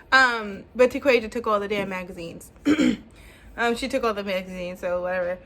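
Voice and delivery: usual speaking voice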